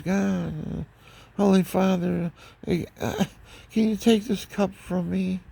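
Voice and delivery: high, pleading voice of Jesus praying to the Father; pleading prayer. Retold or imitated. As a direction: beg the Father to take the cup away, voice lifted high.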